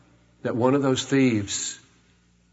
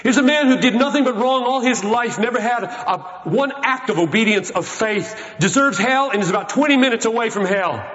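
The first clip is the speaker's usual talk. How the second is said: higher, pressing faster to the end; heated preaching; 7 semitones higher than usual. Acted. Preacher lays out the thief's guilt, heated and fast. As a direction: drive the point home hard.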